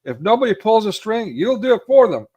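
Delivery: loud, high mocking tirade; acted-out berating, high and punchy; ripping into him. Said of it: "like, ripping into him".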